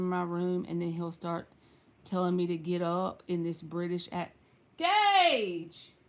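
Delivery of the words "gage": loud high call, name stretched out. Real, not acted. Mother calling her son again to come do the voice, loud call. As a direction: holler the name so he hears you.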